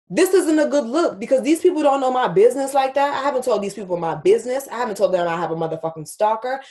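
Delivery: fast, agitated venting; heated rant. Real, not acted. Venting that her safe space was invaded, heated rant. Venting about exposed private business.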